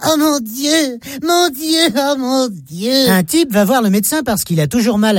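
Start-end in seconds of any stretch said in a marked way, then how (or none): 0.1-2.9 high, strained mock oh mon Dieu; imitating mum crying out. Little girl imitating mum on the bathroom scale, mock anguished cry. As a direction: wail oh mon Dieu as if the scale horrifies you. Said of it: "elle crie".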